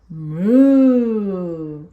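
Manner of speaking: low mooing cow sound. Shown as a demonstration. Teacher mooing for little learners. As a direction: moo like a cow for the kids.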